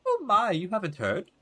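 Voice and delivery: put-on high, swooping old lady voice; gossipy old woman. Old neighbor about to share gossip, high mock concern. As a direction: play the neighbor itching to gossip.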